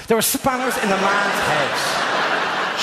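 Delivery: slow, drawn-out disbelief; astonished. Real, not acted. Reacting to the spanners answer, drawn out. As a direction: stretch it out in mock horror.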